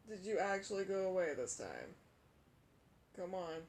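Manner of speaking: ordinary talk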